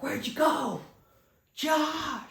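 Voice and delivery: high yelled call across the dark. Retold or imitated. Friend calling into the night for missing Josh. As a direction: shout his name to find him.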